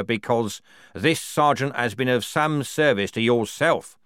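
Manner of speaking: loud, officious report; reluctant captain. Acted. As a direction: report formally to a superior. Captain voiced in story.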